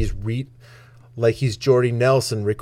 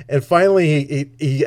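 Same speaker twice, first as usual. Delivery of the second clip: ordinary talk, nothing marked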